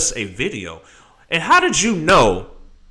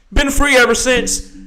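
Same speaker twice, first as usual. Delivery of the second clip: proud boast; 11 semitones higher, 5 dB louder than usual. Real, not acted. Boasting about his release.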